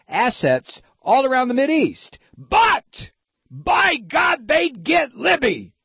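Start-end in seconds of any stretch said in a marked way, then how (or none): 2.4-5.7 high, slow, drawn-out mock triumph; mock triumphant cry. Host mocking the supposed plot to get Libby, mock triumph. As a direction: crow each word slowly, pitched way up.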